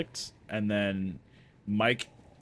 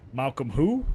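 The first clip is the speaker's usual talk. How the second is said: louder, rising call and response prompt; quizzing call; 4 semitones higher, 7 dB louder than usual. Retold or imitated. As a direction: throw the question out like a chant leader. Mike quizzing Victor.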